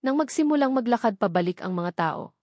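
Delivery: usual speaking voice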